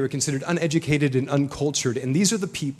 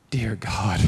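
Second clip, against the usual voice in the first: awed whisper; 4 semitones lower than usual. Retold or imitated. Overwhelmed holding newborn.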